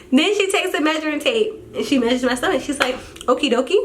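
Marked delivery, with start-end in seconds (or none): 3.2-3.8 mimicking the doctor. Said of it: "I'm telling y'all what she said".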